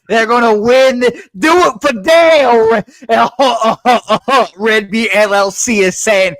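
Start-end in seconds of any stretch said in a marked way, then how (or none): 0.1-5.1 excited. Acted. Hyping up co-host on bet.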